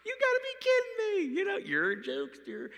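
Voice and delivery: mock laughing shout at a joke; laughing at God. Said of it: "like, laughing at God".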